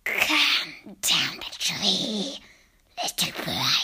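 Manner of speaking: very slow, low, whispered coaxing; rasping witch whisper. Acted. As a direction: lure the child down with a creepy hiss. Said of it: "Her voice had a curious rasping quality".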